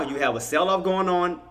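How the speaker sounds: ordinary delivery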